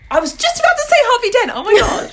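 high, loud and quick; excited shout. Real, not acted. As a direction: blurt it out in delight. Guessed the same villain together.

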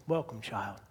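low, soft welcoming voice; Aslan. Acted. Lion greeting the child who found him, low and gentle. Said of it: "And Aslan says".

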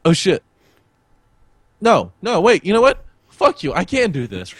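retold shift from panic to stubborn resolve; a hint of a panicked friend turning stubborn; defiant friend. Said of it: "He was like".

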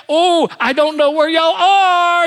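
slow, loud pretend cluelessness; hammed-up grandpa pretending not to know; mock ignorance. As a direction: grandpa playing hide and seek with grandkids, mock puzzled. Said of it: "And so I pretend".

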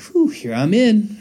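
high, slow exaggerated whew of relief; hammed-up relieved sigh; mock relief. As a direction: stretch it out like you are off the hook. Joking relief at a churchgoer.

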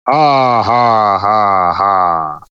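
descending mock evil ha ha; falling staged villain cackle; evil villain laugh.

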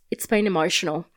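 low, soft deadpan delivery; deadpan film quote. Retold or imitated. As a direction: drop it quietly like a movie line. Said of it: "just went".

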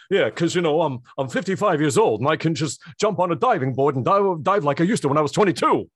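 loud put-on bragging voice; hammed-up bragging old man; mock character voice. Mocking Dangerfield character.

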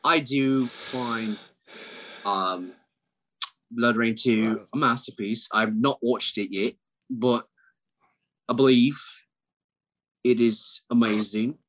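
measured British accent with long pauses; deliberate, halting British imitation; fake British accent. Shown as a demonstration. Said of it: "do your British accent".

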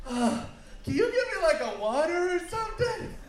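gasping for air, high and strained; out of breath. Pretend. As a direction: wheeze out the request, spent. Winded, asks for water.

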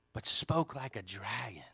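hushed, breathy warning whisper. Acted. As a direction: drop to a whisper for the dragon. Reads verse, dramatic hush.

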